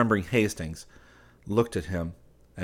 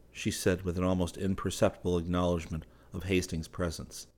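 ordinary delivery, nothing marked

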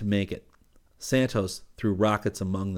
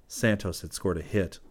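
ordinary delivery, nothing marked

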